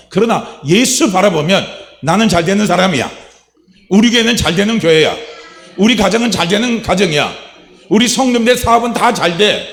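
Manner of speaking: fervent pulpit declaration; preaching declaration. Acted. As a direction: proclaim it to the congregation with growing force.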